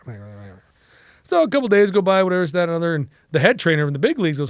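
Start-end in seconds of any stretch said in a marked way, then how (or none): 0.2-0.6 low, very soft brush-off; dismissive mumble. Retold or imitated. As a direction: drop it away under your breath. Dismissing Tommy, retold.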